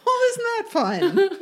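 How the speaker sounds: high, laughing delight; giggly high voice. Retold or imitated. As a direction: squeal it out through a laugh. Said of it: "and I'm like".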